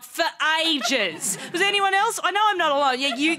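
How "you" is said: normal talking voice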